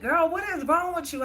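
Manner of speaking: high mock-worried mom voice; mother asking her sobbing daughter what's wrong, alarmed; mom's alarmed question. Mom reacts to her bawling.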